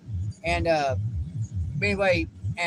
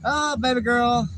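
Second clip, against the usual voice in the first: slow, louder, fond coo; 5 semitones higher, 4 dB louder than usual. Real, not acted. Dad coos fondly at his baby girl. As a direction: coo at her like she's the sweetest thing.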